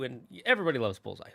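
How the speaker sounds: ordinary talk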